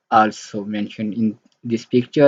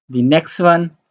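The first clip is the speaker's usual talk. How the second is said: ordinary talk, nothing marked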